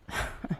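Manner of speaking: soft, quiet little laugh; short chuckle. Real, not acted. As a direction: chuckle briefly at your own joke. Chuckle after mock complaint.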